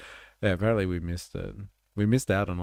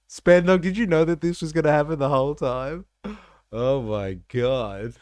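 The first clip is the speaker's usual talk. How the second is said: high and loud, stunned disbelief; astonished; 9 semitones higher, 6 dB louder than usual. Real, not acted. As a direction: shout out your shock at the surprise guest. Shocked at surprise guest.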